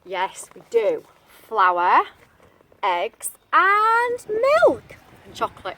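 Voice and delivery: ordinary delivery, nothing marked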